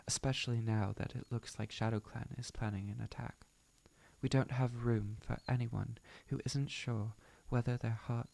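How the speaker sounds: firm put-on British accent. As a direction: speak as a British-accented clan leader.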